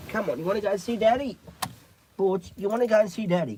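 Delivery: high, quick coaxing questions; talking to dog. Real, not acted. Owner calling Butch to see Daddy, high coaxing.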